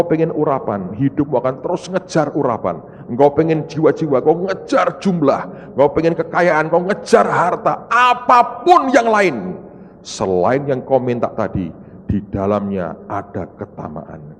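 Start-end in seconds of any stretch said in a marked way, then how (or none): none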